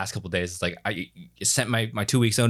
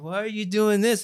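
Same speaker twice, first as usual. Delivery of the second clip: daughter's voice; 9 semitones higher than usual. Retold or imitated. Father quoting sleepwalking daughter.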